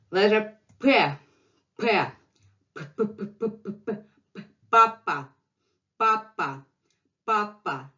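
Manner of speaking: P, P, Papa repeated crisply for students; letter drill. Shown as a demonstration. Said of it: "drilling letter sound".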